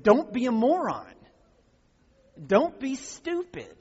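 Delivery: loud, high "Don't be a moron", punching "moron"; emphatic rebuke. Retold or imitated. Preacher voicing Jesus rebuking believers, loud and high. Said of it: "So he said".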